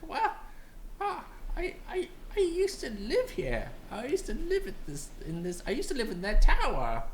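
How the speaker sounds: put-on high, wistful old bird. Old bird telling heroes about his tower, high voice.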